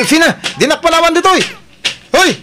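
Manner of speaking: put-on loud, high call after a girl; playful loud hail to a friend. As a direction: shout to her across the field. Calling after Fina across the farm.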